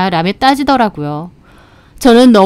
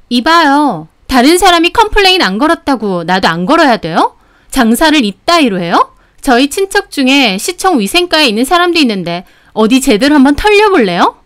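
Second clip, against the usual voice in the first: higher-pitched, indignant customer; angry threatening; 4 semitones higher than usual. Acted. Customer threatening the owner with a hygiene inspection, angry. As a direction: challenge with sharply rising questions.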